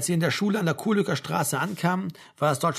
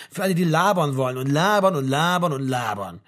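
mocking drone; 4 dB louder than usual. Achim mocks endless talking.

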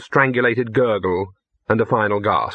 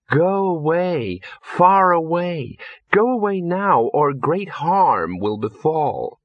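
high, slow, drawn-out sweet warning; sweetly; 5 semitones higher, slower than usual. A narrator's reading. Entranced Ginger warns Victor away, slowly and sweetly. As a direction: draw it out sweetly, like someone entranced. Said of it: "she said sweetly".

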